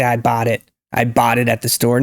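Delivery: "bought" as "bot", open AH; unrounded AH in "bought"; wrong AH vowel. Shown as a demonstration. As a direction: say "bought" like baht. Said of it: "They don't say".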